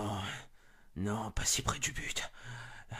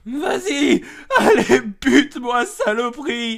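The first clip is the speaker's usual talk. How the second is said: high, loud, slow sobbing taunt; crying, furious challenge; sobbing defiance; 11 semitones higher, 16 dB louder, slower than usual. Acted. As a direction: dare the killer through tears. Defying the killer, sobbing.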